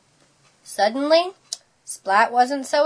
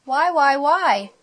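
higher-pitched, insistent repeated question; pestering; 5 semitones higher than usual. A narrator's reading. Splat keeps questioning his teacher, pestering. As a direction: keep nagging like a curious child.

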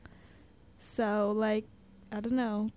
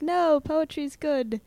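slow, raised, louder mock protest; emphatic drawn-out objection, pitched up; insistent teacher; 6 semitones higher, 7 dB louder, slower than usual. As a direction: imitate a teacher pleading the case. Imitating teacher insisting to class.

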